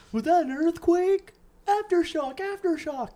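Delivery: mock panicked posts. Mocking Facebook posters, high and frantic.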